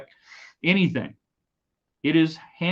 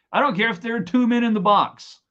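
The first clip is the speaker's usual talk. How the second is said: I don't care if there are two men in the box, raised and higher; emphatic raised repeat; louder insistent repeat; 10 semitones higher, 4 dB louder than usual. Real, not acted. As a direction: push "don't" harder, louder.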